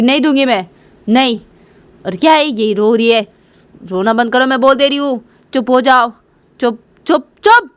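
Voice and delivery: high, cross refusal and orders; acted irritated scolding; angry hushing. Angry mother hushing crying baby.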